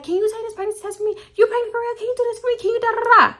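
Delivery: very high, quick little mimicking voice; high-pitched mock girl voice; imitating pleading girls. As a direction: squeak out the favour like a mock plea. Mimics girls begging favours.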